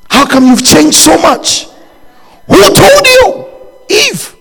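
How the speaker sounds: lightly voiced God questioning, building to a high cry; a hint of a grieving God asking Eve.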